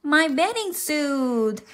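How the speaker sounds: mild, high-pitched shout. A narrator's reading. Froggy admitting his loss to mother, mild high shout. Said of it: "he s-, shouted".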